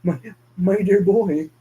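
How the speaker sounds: grieving father. Acted. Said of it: "and his eyes are in tears".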